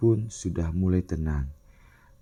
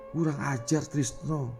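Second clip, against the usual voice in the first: higher-pitched, held-back fury; seething anger; 7 semitones higher, 5 dB quieter than usual. A narrator's reading. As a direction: curse him under restraint, softly. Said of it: "ucap Pak R T geram".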